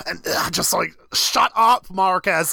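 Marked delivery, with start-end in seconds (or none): 1.1-2.5 high, louder exasperated "shut up"; exasperated scolding. Pretend. Podcast host telling off Marcus, exasperated. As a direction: scold him, pitched up in exasperation.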